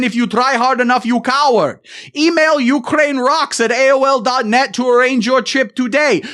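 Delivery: a mock Ukrainian tourism ad read in a thick Eastern European accent; heavy Eastern European accent. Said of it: "read it in the heavy accent".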